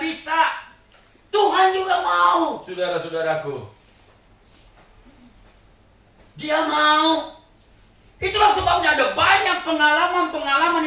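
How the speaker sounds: loud, high, laughing sermon story; fiery preaching. Acted. Preacher exhorting at full force.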